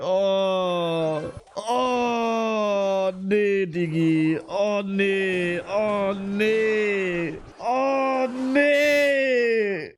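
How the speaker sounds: whining dismay. Real, not acted. Losing, can't defend.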